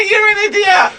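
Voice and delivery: very high squeal through laughter; real hysterical laughing. Real, not acted. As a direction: shriek it while helpless with laughter. Helpless laughter at the storyteller.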